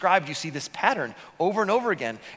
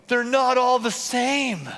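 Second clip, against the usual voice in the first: awed realization; 7 semitones higher, 3 dB louder, slower than usual. Retold or imitated. Quoting amazed colorblind kid.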